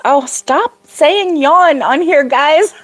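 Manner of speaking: slow, high mock complaint; teasing, begging them to stop; slow playful protest; playful whine. Real, not acted.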